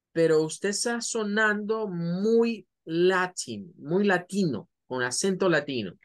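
ordinary talk

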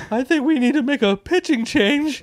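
high, whiny mock-tearful voice; phone in as a comically sobbing fan; weepy radio caller. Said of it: "identify him from, like, uh, calling in".

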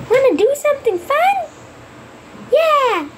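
high, eager play voice; excited child. Acted. Children planning a game together, excited. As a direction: pitch it up like an eager kid.

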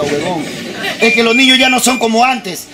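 normal talking voice

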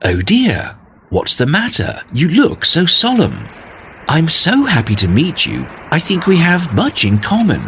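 lightly voiced friendly snail. Snail greets Norton.

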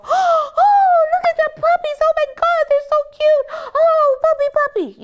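squealing, gushing guest; super high excited. Shown as a demonstration. Guest arriving to see the puppies, super high excited squeal. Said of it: "that super high excited business".

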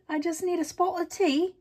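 high, giggly fake British accent; mock British accent. Crafter joking about tea, high hammed-up British accent. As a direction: ham up a British accent through laughter. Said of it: "my little British accent".

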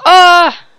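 sharp high loud "ah" cry; startled loud yelp. Real, not acted. Player caught by a hit, loud high yelp. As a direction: yelp as you nearly fall.

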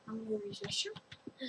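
hushed whisper. Real, not acted. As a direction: whisper it so nobody hears. Leaving to use the restroom.

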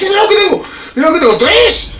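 high excited shouting. Real, not acted. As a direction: crow with delight. Showing off a find.

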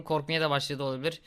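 ordinary talk, nothing marked

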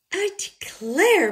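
high, loud, slow exclamation; exclaiming. A narrator's reading. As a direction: declare it in shock. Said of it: "Mama Bear exclaimed".

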